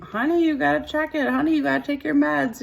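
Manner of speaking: high, sing-song nagging wife voice. Retold or imitated. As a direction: coax him like a fussing wife. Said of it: "The wives are usually like".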